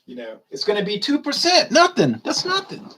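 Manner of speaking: high, sing-song mockery ending in a laugh; mocking the promise. Scoffing at tax promises to Ron, high mocking voice. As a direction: parody the progressives' lowball promise, then laugh. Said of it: "they always promise".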